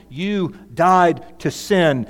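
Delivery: You died to sin, slow and weighty with died stressed; slow emphasis on died. Shown as a demonstration. Pastor stresses the past tense to his flock, slow and weighty. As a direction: lean hard on died. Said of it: "he uses these past tense verbs".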